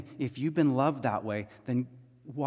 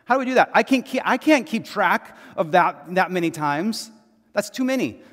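much higher and louder, flustered protest; exasperated Peter; 5 semitones higher, 7 dB louder than usual. Retold or imitated. Peter protesting to Jesus, high and loud disbelief. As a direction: Peter throwing up his hands, pitched way up. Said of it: "And Peter's like".

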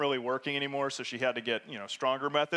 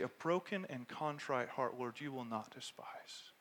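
whisper; 4 semitones lower, 11 dB quieter, slower than usual. Real, not acted. Quoting contrite psalm softly.

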